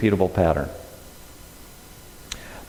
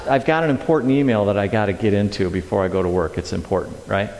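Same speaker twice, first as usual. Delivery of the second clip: ordinary talk, nothing marked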